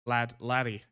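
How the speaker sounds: amateur Scottish accent, trying the words out. Shown as a demonstration. Streamer correcting "mate" to Scots, tentative Scottish accent. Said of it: "I love the Scottish accent".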